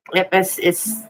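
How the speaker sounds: normal talking voice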